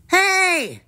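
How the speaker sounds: high, loud "hey"; indignant high holler; calling after. A narrator's reading. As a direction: shout after someone running off. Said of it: "the frog called after her".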